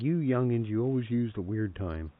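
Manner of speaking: loud, indignant complaint, retold; playful loud scolding; mock grumbling grandpa. Grandpa bickering about military time.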